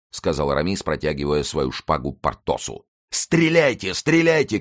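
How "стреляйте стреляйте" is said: high, urgent battlefield command; urgent shout. A narrator's reading. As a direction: shout the order, pitch jumping high. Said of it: "кричал Мардаунт". (высокий настойчивый крик)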